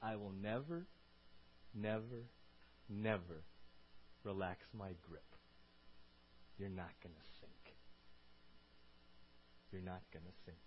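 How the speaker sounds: hushed deep promise, pausing between phrases; calm reassurance. Acted. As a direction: answer panic with quiet, unhurried certainty. God answers the terrified disciples.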